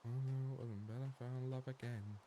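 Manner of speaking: ordinary talk, nothing marked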